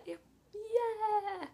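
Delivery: high, stretched-out yeah; a loud, hammed-up little hooray; mock cheer.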